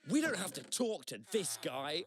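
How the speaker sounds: high, slow, mock-offended dismissal; retold snub. Strangers in the queue snubbing the joker, mock offended. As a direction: imitate an affronted group shutting him out.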